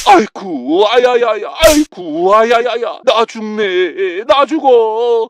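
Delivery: sharp "아야야야" yelps, then slow dragged groans; yelps of pain. Acted. Father hit again, yelping and groaning slowly. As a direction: yelp at each blow.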